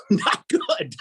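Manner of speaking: high-pitched, laughing admission. Real, not acted. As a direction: say it through a burst of laughter. Laughing answer to "how'd that work.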